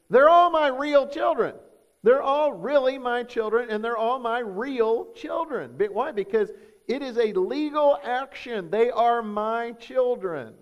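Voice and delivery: high, loud fatherly declaration; emphatic insistence. Retold or imitated. Adoptive father answers nosy strangers about his children, emphatically insistent. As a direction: insist on it, loud and high, drawing out key words.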